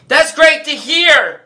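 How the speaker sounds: high, loud, drawn-out fake delight; hammed-up sarcastic customer-service cheer. Shown as a demonstration. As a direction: overdo the enthusiasm to needle a guest. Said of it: "It's the sarcastic way of saying it".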